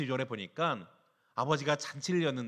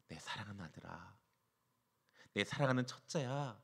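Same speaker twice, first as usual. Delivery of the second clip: much softer, hushed loving call; tender father; 5 semitones lower, 9 dB quieter than usual. Acted. Father answering his resentful elder son, hushed and tender. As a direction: cradle the words like a father to his hurt son. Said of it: "아버지가 뭐라 할게요?".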